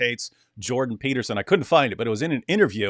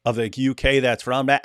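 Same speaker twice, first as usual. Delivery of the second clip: ordinary delivery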